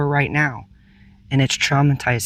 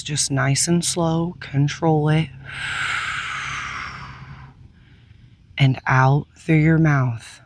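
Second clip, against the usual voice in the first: calm, unhurried meditation guide with long pauses; soothing guide through breath and release; guided meditation. Real, not acted.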